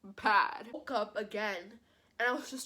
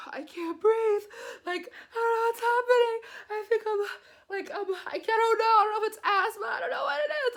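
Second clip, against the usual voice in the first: high, loud, tearful panic; reliving the fear, voice cracking high; retold panicked crying; 12 semitones higher, 8 dB louder than usual. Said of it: "And I was just crying and crying".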